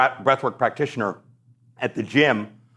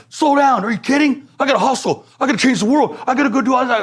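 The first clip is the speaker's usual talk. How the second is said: high, louder, incredulous hustler; frantic disbelief; 13 semitones higher, 4 dB louder than usual. Retold or imitated. Him protesting to the practitioner that he must hustle, frantic. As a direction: protest in a wound-up panic. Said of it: "And I'm like".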